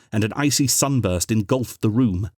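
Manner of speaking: ordinary delivery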